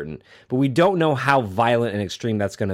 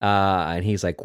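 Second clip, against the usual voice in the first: ordinary talk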